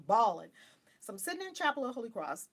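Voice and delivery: ordinary talk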